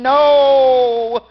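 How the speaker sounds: loud anguished cry; grief-stricken scream. Acted. A father screaming in grief coming out of the room, loud.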